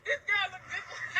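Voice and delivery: giggling through the words; laughing. Real, not acted. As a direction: laugh while you say it. Laughing mid-sentence.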